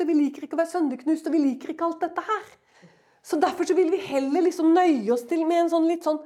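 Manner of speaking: high, loud, driving sermon; fiery preaching. Acted. Preacher rousing the congregation, loud and high. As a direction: preach it out at full force.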